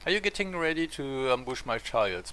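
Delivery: ordinary delivery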